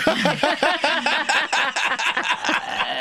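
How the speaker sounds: long real laugh; a giggle that rolls on. Real, not acted. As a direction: laughs at her own joke about him. Laughs at her own joke.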